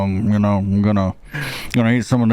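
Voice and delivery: light put-on Mississippi accent. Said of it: "that was my Mississippi accent".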